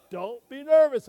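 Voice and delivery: high, loud encouragement; loud reassurance. Acted. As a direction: boom it to pump him up. Reassuring nervous David.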